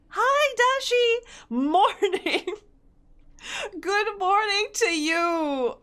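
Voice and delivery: excited greeting. Real, not acted. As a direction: greet them like a long-lost friend. Greeting a chat viewer.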